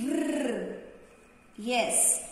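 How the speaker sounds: normal talking voice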